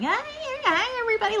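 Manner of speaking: imitating herself greeting the gym crowd; goofy cheerful greeting. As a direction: burst in greeting everyone. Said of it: "and I'm like".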